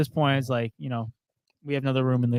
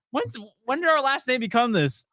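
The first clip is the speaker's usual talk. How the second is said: higher-pitched mock disbelief; baffled retelling; 7 semitones higher than usual. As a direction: question the parents, puzzled and high. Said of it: "had a conversation with my parents".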